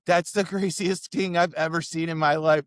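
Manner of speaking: high, loud imitation of astonishment; retold cry of disbelief; amazed girl. Girl reacts to snorted spilled shots.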